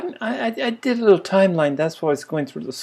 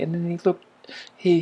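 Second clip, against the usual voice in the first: ordinary talk, nothing marked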